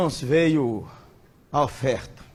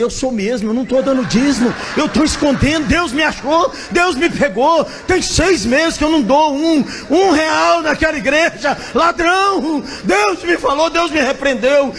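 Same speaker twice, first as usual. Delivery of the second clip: high, loud, shouting confession; ecstatic confession; 9 semitones higher, 8 dB louder than usual. Retold or imitated. Second friend confessing he stole the tithe, loud high shout. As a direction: confess it at the top of your lungs. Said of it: "eu falei".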